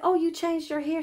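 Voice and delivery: a touch of retold surprise; lightly noticing her new hair; surprised question.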